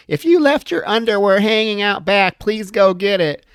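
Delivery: mock PA announcement over the speaker; loudspeaker announcement. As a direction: read it like a school loudspeaker. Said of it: "just saying random things like".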